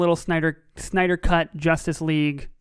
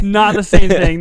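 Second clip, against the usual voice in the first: laughing; 3 semitones higher, 10 dB louder than usual. Real, not acted. Laughing denial.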